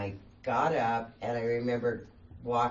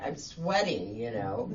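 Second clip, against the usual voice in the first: usual speaking voice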